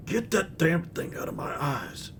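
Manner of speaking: high, soft annoyed character voice; gruff character voice. Acted. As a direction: complain about the light, squinting. Grumpy character voice.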